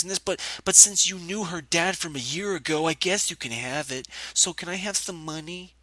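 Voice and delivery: high, put-on begging voice; mock pleading. Mockingly acts out begging for money.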